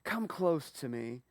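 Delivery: soft, slow, gentle; tender invitation. Acted. Joseph repeating the call to his brothers, soft and slow. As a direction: ease it out quietly, drawn out. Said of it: "you don't get close to the governor".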